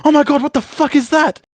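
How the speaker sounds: high, loud startled outburst; shocked yelp. Retold or imitated. Companion Mathis shrieks at the strange creature, startled and loud. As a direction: jump out of your skin at the sight. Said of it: "you hear Mathis go".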